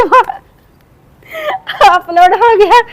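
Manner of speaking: high squeal of a laugh then a wince; mortified giggle over the upload; embarrassed laugh. Real, not acted. Embarrassed about the accidental upload.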